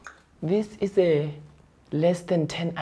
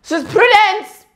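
high, loud call across store; 13 semitones higher, 12 dB louder, much slower than usual. Acted. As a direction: shout a name across the shop. Calling colleague.

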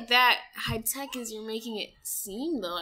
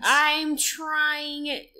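slow emphatic; 6 semitones higher, 6 dB louder than usual. Real, not acted. Joking over-earnest plea.

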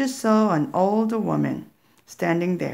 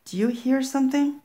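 nervous; 7 semitones higher, faster than usual. Acted. Kids hear knocking at night.

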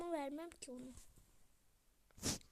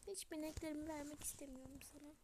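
usual speaking voice

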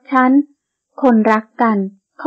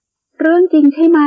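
higher, slow, worried; anxious question; 7 semitones higher, 4 dB louder, much slower than usual. A narrator's reading. Heroine doubting the sheik's story, slow and worried. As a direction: ask it in disbelief, slowly.

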